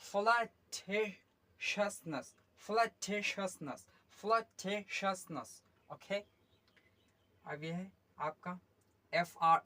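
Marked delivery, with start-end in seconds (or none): 0.0-5.6 फ्लटेशसनेस drawn out slowly, then repeated steadily; model pronunciation. Shown as a demonstration. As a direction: repeat फ्लटेशसनेस clearly for the class to copy. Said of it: "क्या हो जाएगा ये?". (फ्लटेशसनेस को धीरे और साफ़ दोहराइए)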